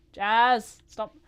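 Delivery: one loud shouted name; calling out. Real, not acted. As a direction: yell the name to stop her. Calling after a fleeing villager.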